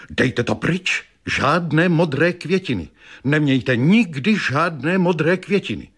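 high, urgent exclamation without much volume; cried out. A narrator's reading. Fortune-teller seeing violets in the vase, crying out in alarm. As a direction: exclaim a warning, pitch held high. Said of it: "a vykřikla".

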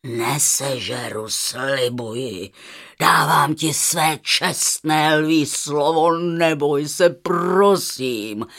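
low, slow lion voice making a vow; deep, measured lion reassurance; earnest lion. A narrator's reading. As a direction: promise solemnly as a big lion, slowly. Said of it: "řekl lev".

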